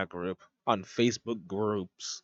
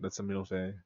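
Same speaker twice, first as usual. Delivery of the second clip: usual speaking voice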